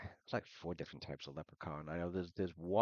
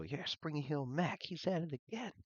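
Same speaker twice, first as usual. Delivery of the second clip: put-on high, drawn-out kid voice; excited kid; 10 semitones higher than usual. One of the kids naming Spring Hill Mac, excited. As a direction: pipe up high like a gossiping kid.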